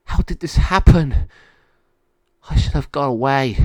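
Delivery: high, loud, upset dog voice; a frightened little dog asking himself; Monty the dog. A narrator's reading. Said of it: "Monty said to himself".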